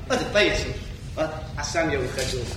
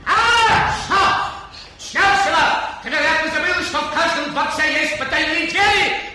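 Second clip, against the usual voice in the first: triumphant shout; 9 semitones higher than usual. Acted. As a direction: catch her in the act. King catches her, calls guards.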